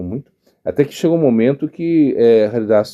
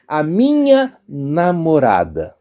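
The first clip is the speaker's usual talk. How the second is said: slow quote; 4 semitones higher, much slower than usual. Shown as a demonstration. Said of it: "se você falar assim".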